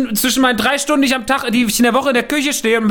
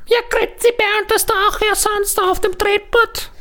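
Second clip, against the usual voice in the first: put-on Swiss accent; 9 semitones higher than usual. Spielt Maria mit Akzent.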